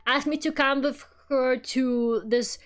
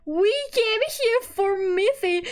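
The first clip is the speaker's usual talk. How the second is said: much higher, slow, giggly outrage; laughing disbelief; 11 semitones higher, slower than usual. Retold or imitated. Two fans at the Miffy cafe, cracking up at Boris. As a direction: squeal it through laughter. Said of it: "like, laughing".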